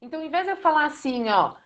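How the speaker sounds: ordinary delivery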